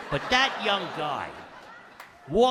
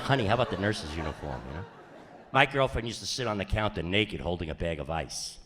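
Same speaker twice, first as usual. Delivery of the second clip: ordinary talk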